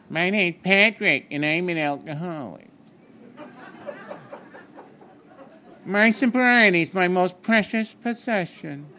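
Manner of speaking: high, slow, lilting little leprechaun voice; mock the solemn old-timer, slow and high; Patrick's solemn voice. A rehab visitor introduces himself, slow high lilting. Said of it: "this is the way I heard it".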